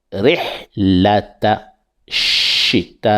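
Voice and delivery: "rihlata shita i" very slow, pressing on shi; slow recitation. Shown as a demonstration. Teacher reciting slowly for pupils, stressing shi. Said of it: "Et on appuie sur la lettre shi".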